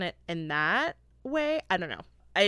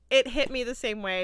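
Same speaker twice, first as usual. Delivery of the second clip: usual speaking voice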